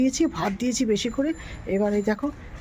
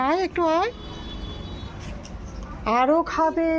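much higher sing-song coaxing; coaxing to eat; 6 semitones higher than usual. Real, not acted. Urging the shy stray to eat, high coaxing. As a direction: coo at the dog to eat its biscuit. Calling the dog closer.

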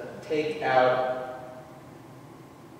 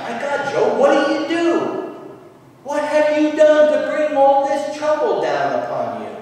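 high, loud, incredulous demand; pitched-up, loud accusation; accusing friend; 11 semitones higher, 6 dB louder than usual. Acted. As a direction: voice an appalled friend demanding answers. Acting Job's accusing friends.

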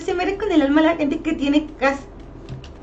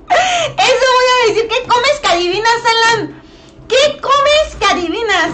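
high, loud, laughing exclamation; excited laughing shout; 7 semitones higher, 4 dB louder than usual. Real, not acted. Thrilled that a viewer guessed her line, high laughing shout.

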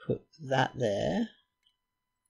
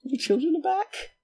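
very high squeal of relief; squealed joy. Real, not acted. Overjoyed the children are back, very high squeal. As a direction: squeak it out in delight.

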